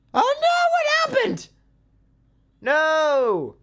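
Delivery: high, loud wail of alarm; shrieked, very high-pitched gamer panic; panicked cry. Real, not acted. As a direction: cry out in sudden dismay. Wagon tips over in game.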